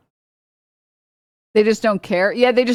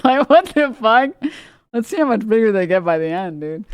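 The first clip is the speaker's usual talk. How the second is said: laughing disbelief; 2 semitones higher than usual. Retold or imitated. Mock gleeful reaction, laughing.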